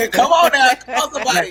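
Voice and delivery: loud, high shout of agreement; rousing amen-corner call. Real, not acted. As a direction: whoop them on like a church congregation. Playful church-style cheering on.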